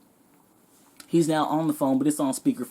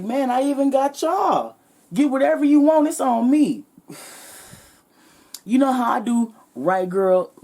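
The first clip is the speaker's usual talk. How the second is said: higher and louder, bragging big spender; show-off guy; 8 semitones higher, 3 dB louder than usual. Retold or imitated. Customer showing off to girls.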